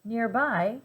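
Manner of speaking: ordinary delivery, nothing marked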